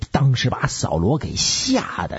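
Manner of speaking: ordinary delivery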